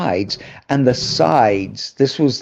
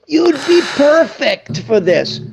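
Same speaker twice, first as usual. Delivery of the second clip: put-on high, slow Maltese-accented delivery; imitated director, high-pitched Maltese accent; 14 semitones higher than usual. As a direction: gush slowly in a hammed-up Maltese accent. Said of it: "That Maltese accent".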